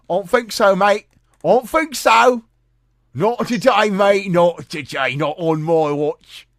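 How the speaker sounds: very loud, high British copper caricature; mocking police officers. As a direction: shout it as exaggerated, scornful British police. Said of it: "And what do they say?".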